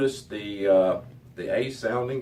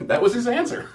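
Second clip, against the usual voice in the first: laughing; 5 dB louder, faster than usual. Real, not acted. Laughing at professor's answer.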